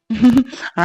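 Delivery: short high laugh to lighten mood; coaxing laugh. Acted. Groom laughing to cheer up crying fiancée, light laugh. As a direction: laugh to make her smile.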